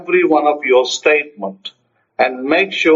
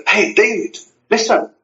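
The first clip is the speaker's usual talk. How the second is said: higher-pitched call to a friend; excited; 7 semitones higher than usual. Acted. Speaker to David on the phone, excited. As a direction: grab his attention with exciting news. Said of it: "you're all excited to hear".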